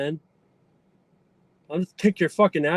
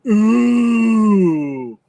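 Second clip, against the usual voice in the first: taunting ooh; 3 semitones higher, 5 dB louder than usual. Shown as a demonstration. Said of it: "hit us with that ooh again".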